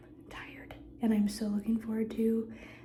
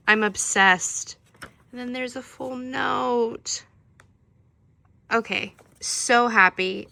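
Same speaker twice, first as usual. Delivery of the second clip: excited; 7 dB louder than usual. Real, not acted. Gushing product pitch.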